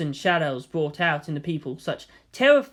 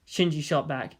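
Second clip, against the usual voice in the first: usual speaking voice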